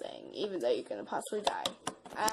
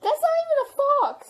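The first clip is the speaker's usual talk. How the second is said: much higher, louder indignant yell; outraged shout; 9 dB louder than usual. Real, not acted. Player yelling at the fake fox, indignant shout. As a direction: shout it in disbelief.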